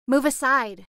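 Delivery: loud, high command. A narrator's reading. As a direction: order them out of the way.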